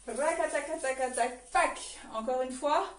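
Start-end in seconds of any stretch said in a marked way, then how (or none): 0.1-1.8 rapid "rakataka" rattle, then a dry "pac"; rhythmic vocal drumroll. Shown as a demonstration. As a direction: sound out the trembling, end on a sharp hit. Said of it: "ce qui va donner".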